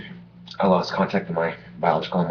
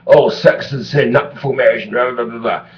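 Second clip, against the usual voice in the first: preachy mocking voice; 5 semitones higher, 7 dB louder than usual.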